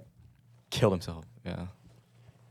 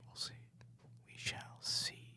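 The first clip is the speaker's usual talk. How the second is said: whispering; 13 dB quieter than usual. Pretend. Whispered banter.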